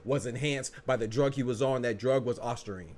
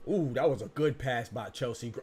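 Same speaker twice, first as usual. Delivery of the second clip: normal talking voice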